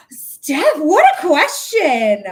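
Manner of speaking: thrilled by a great question; host gasping with joy at a viewer's question; delighted gasp. Real, not acted.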